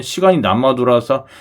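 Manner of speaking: ordinary delivery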